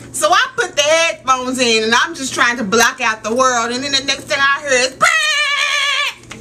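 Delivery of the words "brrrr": high, sustained "brrr" noise; imitated warning blare. Daughter mimicking the noise heard over headphones, high blare. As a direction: blare one long high note.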